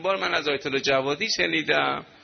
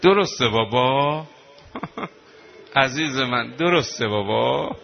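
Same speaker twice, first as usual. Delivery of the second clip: slow, crying daughter's question; tearful plea. Acted. Zeinab asking her dying father through tears. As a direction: plead through tears, drawn out.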